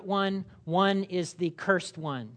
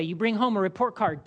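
ordinary delivery

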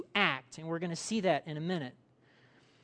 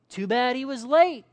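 bystander's jab; 5 semitones higher, 7 dB louder than usual. Acted. Mourner's snide afterthought in acted reaction.

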